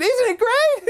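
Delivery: put-on very high delighted child; delighted kid. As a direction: gush over the doll. Boy shows the doll.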